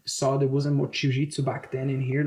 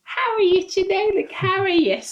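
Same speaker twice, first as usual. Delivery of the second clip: much higher, exaggerated chirpy "How are you today?"; mock broken English; 4 dB louder, slower than usual. Teasing his wife by mimicking her early English, much higher. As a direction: ham up a clumsy English greeting. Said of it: "She speaks properly".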